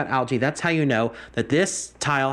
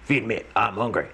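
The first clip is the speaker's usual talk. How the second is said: hungry fish voice; 5 semitones lower than usual. Pretend.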